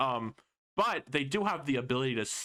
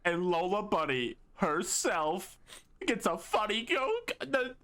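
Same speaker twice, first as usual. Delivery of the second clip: slow, squeaky high, hammed-up hilarity; mock hysterics; slower than usual. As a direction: climb into a strained falsetto as if overcome. Hammed-up retelling of a weak joke.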